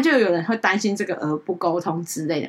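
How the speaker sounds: ordinary talk, nothing marked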